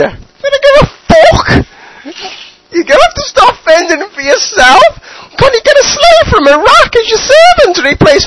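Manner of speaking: high, loud shrieking mum; nagging mother yelling. Acted. Mother yelling at Saddam to hoover, high loud shriek. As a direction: bellow it like a furious mum.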